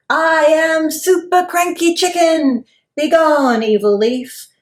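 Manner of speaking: loud, high grand hero proclamation; heroic chicken impression. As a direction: boom it out like a superhero. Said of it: "you were all".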